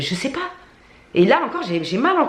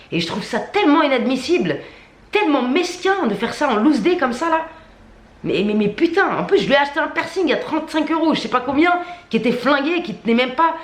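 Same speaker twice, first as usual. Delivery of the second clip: indignant rant; 8 semitones higher than usual. Real, not acted. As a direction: pitch climbs higher, voice pushed in outrage. Furious rant about the piercer.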